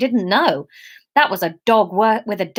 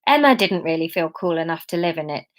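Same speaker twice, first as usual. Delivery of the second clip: ordinary delivery, nothing marked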